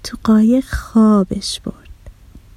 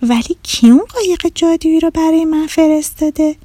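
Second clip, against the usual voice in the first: put-on high-pitched girl wondering aloud; surprised; 7 semitones higher than usual. A narrator's reading. Girl asking who sent the magic boat, surprised. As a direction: ask in high, curious amazement. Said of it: "آدیشه با تعجب گفت".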